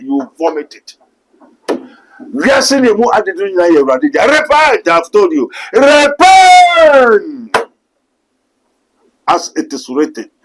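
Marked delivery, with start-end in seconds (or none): 4.2-4.9 preaching call. Real, not acted. Prophet preaching against corruption. 5.7-7.6 preaching shout. Real, not acted. Prophet preaching against corruption.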